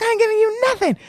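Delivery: hammed-up husband flatly refusing; mocking refusal. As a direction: sneer it out like a stubborn husband.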